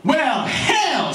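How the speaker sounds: put-on high-pitched, exclaiming young seeker; brash Roy. Roy resolves to find the guru, high and brash. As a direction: burst out with cocky resolve. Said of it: "says Roy".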